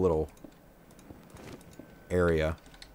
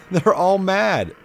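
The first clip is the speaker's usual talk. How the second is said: laughing; 10 semitones higher, 5 dB louder, much slower than usual. Real, not acted. Laughing at angry mobs.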